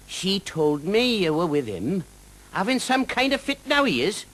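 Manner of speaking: high, lilting Welsh dragon voice, friendly and teasing; a jolly Welsh dragon drawing out his words. Acted.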